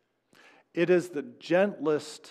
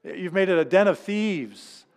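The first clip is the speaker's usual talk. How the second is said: raised hollering voice, louder; 5 semitones higher, 6 dB louder than usual. Retold or imitated. Preacher voicing Jesus hollering at money changers. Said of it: "he starts hollering at".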